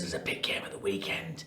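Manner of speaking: hushed whisper, no voice. Real, not acted. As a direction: whisper it softly. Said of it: "Why are you whispering?".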